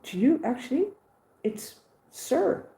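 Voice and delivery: retold indignant comeback; annoyed. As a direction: correct her with annoyed, high-pitched emphasis. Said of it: "I was getting really annoyed".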